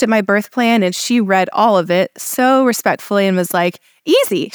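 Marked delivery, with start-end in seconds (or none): 4.1-4.5 bright, lifted "Easy" quoted as the midwife said it; a touch of retold excitement; retold enthusiasm. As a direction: echo her eager yes, lightly. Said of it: "like, enthusiastically".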